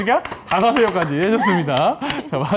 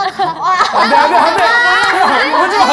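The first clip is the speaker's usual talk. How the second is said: panicked shout; 13 semitones higher, 10 dB louder than usual. Pretend. 장난스러운 비명.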